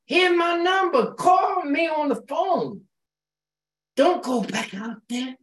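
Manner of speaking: high, pleading caller voice; urgent caring plea. Retold or imitated. To a member at home, an urgent high plea. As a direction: beg them to stay away from there. Urging a member on her visit.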